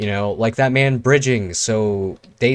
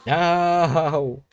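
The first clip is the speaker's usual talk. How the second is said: shout; 6 semitones higher than usual. Real, not acted. Killed by another player.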